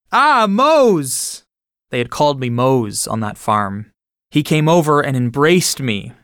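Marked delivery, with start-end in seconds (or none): 0.1-1.5 high, louder cry of surprise at a familiar face; gasp of recognition. A narrator's reading. A farm laborer recognizing an old friend, gasping in surprise. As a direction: gasp out the name in astonished joy. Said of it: "He gasped".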